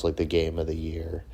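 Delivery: ordinary talk, nothing marked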